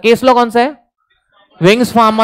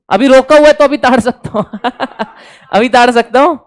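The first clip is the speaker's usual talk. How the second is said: breaking into laughter mid-joke; cheeky joke dissolving into laughter; amused, joking; 3 semitones higher than usual. Real, not acted. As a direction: say it through a laugh. Joking about ogling before marriage.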